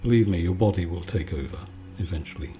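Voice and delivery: ordinary talk, nothing marked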